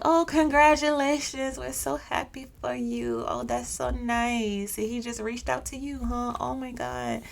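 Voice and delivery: high, gushing, over-sweet praise, slowed down; fake congratulations. Coworkers gushing fake congratulations, high and sugary. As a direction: coworkers faking delight at his big deal. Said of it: "Doing all that fake congratulatory stuff".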